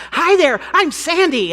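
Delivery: high, loud, eager hello; bright-eyed newcomer bursting to say hi; excited greeting. Retold or imitated. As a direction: introduce yourself with bubbly over-eagerness. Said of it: "I'm all excited".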